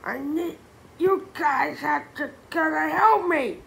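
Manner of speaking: high, loud panicked plea; crying out. A narrator's reading. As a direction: beg for help in a panic. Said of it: "he cried".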